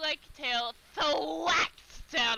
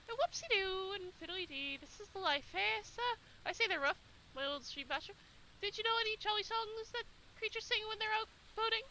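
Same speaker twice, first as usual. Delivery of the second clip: jolly hare voice; 9 semitones higher, 4 dB quieter than usual. Acted. Dottie chirps happily.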